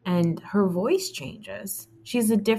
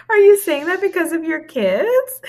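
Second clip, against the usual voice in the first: mocking voice; 9 semitones higher, 6 dB louder than usual. Mocking Mia's voice.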